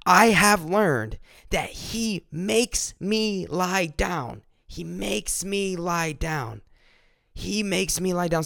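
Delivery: David's calm, settled conclusion; slow emphatic testimony. Retold or imitated. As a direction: let each word of "lie down" sink in. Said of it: "But he's saying".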